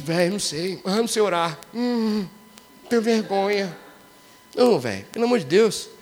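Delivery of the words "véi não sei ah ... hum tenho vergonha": mock shy excuse. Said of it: "Mas tem gente".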